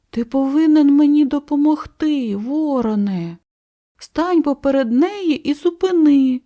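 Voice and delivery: high, insistent storybook plea; urgent plea. A narrator's reading. Cow pleads for help on swing.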